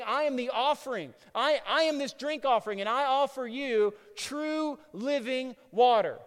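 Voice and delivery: emphatic preacher voicing a cried-out declaration; crying out. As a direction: declare it boldly to the crowd. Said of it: "he cried out".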